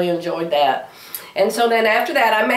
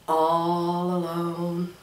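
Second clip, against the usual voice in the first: slow, softer and lower all alone; slow soft repeat; 3 semitones lower, 6 dB quieter, much slower than usual. Shown as a demonstration. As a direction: savour it slowly, hushed. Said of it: "Do you hear the sound of".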